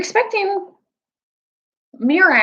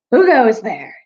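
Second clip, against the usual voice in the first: a louder, snarling sentry's challenge; 2 semitones higher, 6 dB louder than usual. A narrator's reading. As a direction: demand who's there with bared teeth. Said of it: "asked them in snarling voices".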